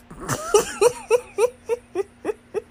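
loud, high cackle; big laugh. Real, not acted. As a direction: burst out laughing. Laughing at her own punchline.

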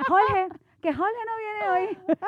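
high, slow, laughing Caribbean "Jorge, que Jorge no viene hoy"; mock Caribbean student. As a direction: ham up the student who can't say "Jorge". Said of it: "y uno". (acento caribeño exagerado y burlón, entre risas)

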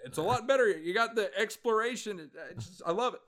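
high-pitched presidential impression; Obama. Podcaster praises the old game, high mock-presidential voice. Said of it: "I haven't done my Obama one yet".

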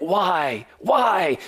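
Joseph's cry from the pit, acted with rising force; retold despairing cry. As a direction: cry out the question in mild mock despair. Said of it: "Joseph cried out in despair".

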